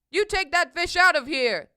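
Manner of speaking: loud, forceful order; indignant loud demand; angry crowd's yell. A narrator's reading. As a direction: yell it to get rid of the fish. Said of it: "they yelled".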